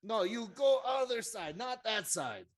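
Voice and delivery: high, insistent correction; Bruno. Acted. Correcting which side the player goes to, high and insistent. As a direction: pitch it up and insist. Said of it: "Bruno says".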